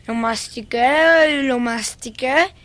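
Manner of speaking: slow, savoured repetition; drawn-out storytelling. Acted. Girl tells of chewing a beetle, slowly drawn out. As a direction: stretch each word as if chewing it.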